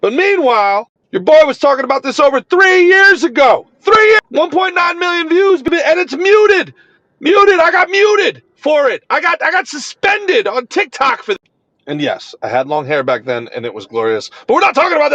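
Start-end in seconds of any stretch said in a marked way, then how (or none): 1.1-11.2 high, louder indignant outburst; fired up. Real, not acted. Creator venting to followers about being muted, fired up. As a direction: vent loudly in rising outrage. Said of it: "Anyway, I'm fired up".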